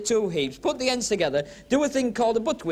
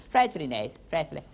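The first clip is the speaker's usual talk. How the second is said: mock posh English; 5 semitones lower than usual. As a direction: send up a posh English toff, a little lower. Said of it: "the ones who talk like that".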